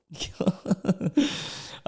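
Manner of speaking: high silent wheeze of laughter; a breathy airless cackle; real laugh, high and breathless. Real, not acted. Laughing at the comment.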